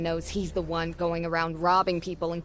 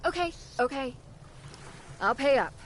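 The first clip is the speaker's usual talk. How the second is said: higher-pitched, cowed giving in; placating, pitched up and yielding; intimidated surrender; 6 semitones higher, 4 dB quieter than usual. Acted. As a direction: back down quickly under a threat. Vendor gives in to threats.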